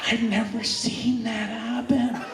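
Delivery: slow, hushed, dumbfounded voice; put-on dazed bystander's voice; stunned onlooker. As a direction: say it slowly in stunned disbelief. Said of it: "in his mind, he's like".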